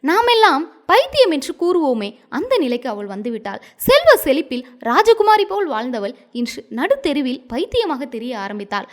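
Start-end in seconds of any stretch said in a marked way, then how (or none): none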